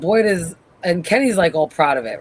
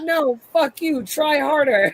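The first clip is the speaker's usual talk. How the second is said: laughing quote; 9 semitones higher than usual. Retold or imitated. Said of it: "And Boyd's like".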